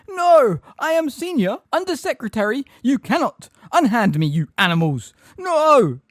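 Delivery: very high, loud panicked protest; shrieking. A narrator's reading. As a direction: shriek as if being seized. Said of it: "he heard Umbridge shriek".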